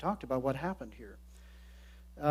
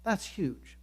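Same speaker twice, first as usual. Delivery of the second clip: usual speaking voice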